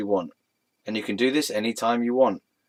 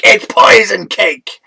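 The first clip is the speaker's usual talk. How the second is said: louder, higher and slow; hammed-up horror reveal; dramatic gasping announcement; mock alarm; 8 semitones higher, 11 dB louder, slower than usual. Mock alarm acting a scene.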